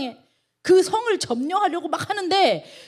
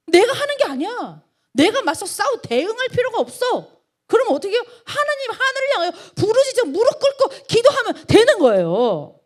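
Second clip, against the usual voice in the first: higher, louder, building preacher's cry; fervent preaching; 9 semitones higher, 6 dB louder than usual. Acted. Pastor urging the congregation to cry out in prayer, fervent and loud. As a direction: drive it home to the congregation, rising to a cry.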